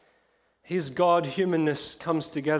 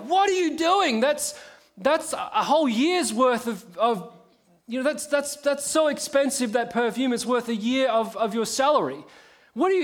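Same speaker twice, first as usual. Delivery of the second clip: bystanders' indignant outcry; 7 semitones higher than usual. Retold or imitated. Bystanders scolding the woman over the wasted perfume, indignant outcry. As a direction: scold her for wasting the perfume. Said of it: "all the people standing around are like".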